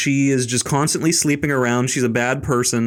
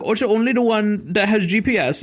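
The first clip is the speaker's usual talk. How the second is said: higher, put-on German accent; mock German accent; 6 semitones higher than usual. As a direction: imitate the clerk's bad German accent. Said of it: "some random German accent".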